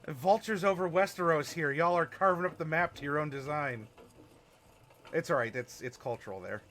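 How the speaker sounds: ordinary talk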